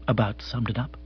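ordinary delivery, nothing marked

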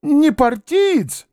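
high, slowing, anxious questions; anxious old man. A narrator's reading. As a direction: fuss over the guest, then worry. Old man's anxious hospitality.

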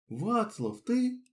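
rising high in disbelief; a startled, delighted recognition; surprised. Acted. Girl recognises him in surprise.

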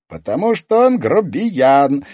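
high, loud, slow merry retort; playful boyish cheer; cheerful. A narrator's reading. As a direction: answer gleefully, drawing it out. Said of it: "весело ответил Никита".

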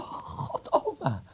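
breathless then a high thrilled cry; delighted gasp. Acted. Grandson thrilled by the gift, breathless surprise. Hears about the film camera.

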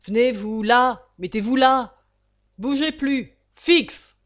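loud, rising prison-guard commands; shouted drill orders; barked orders. A narrator's reading. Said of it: "ordonna avec l'éternelle urbanité des prisons".

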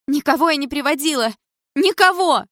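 high-pitched, loud protest; loud, hysterical denial. A narrator's reading. Mother denying her husband's accusation, loud and hysterical. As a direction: cry it out, close to hysteria. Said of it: "так же громко отвечала мама. В её голосе слышались истерические нотки".

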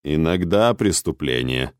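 low, slow, impassive confirmation; unperturbed. A narrator's reading. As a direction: confirm it without any stir. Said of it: "невозмутимо подтвердил Архонт". (невозмутимо)

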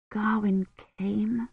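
soft, slow, faint voice; weak inward thought. A narrator's reading. Kivrin learns Gawyn came, thinking weakly. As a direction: think it feebly, barely voiced. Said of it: "Kivrin thought weakly".

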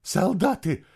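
high, panting cry of alarm; breathless cry. A narrator's reading. Stableman warns of soldiers, breathless cry. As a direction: cry out the warning while short of breath. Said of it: "задыхаясь, выкрикнул тот".

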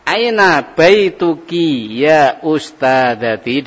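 slow, careful teacher model with AINA stressed; slow drilled Arabic phrase for the class. Shown as a demonstration. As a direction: AINA stressed, rising to ya ustadati. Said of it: "Yang berubah sekarang karena perempuan jadi baituki".

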